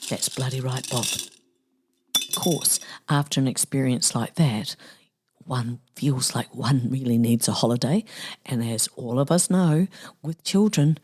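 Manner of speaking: ordinary talk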